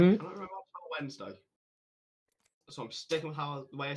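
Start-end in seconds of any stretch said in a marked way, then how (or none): 0.0-1.4 loud fast complaint. Real, not acted.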